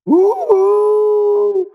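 a sudden high-pitched delighted "ooh"; high excited ooh. Real, not acted. Preacher thrilled by the scripture, high delighted ooh. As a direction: squeal it out at the word.

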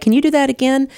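high, astonished question; amazed doctor. Retold or imitated. Orthopedic doctor seeing her use the walker, high amazed question. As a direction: imitate a stunned orthopedist, pitched high. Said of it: "And then he's like".

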